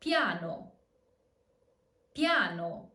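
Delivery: I in piano said as Y; piano with ya glide. Shown as a demonstration. Teacher modelling piano for learners with ya glide. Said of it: "as in".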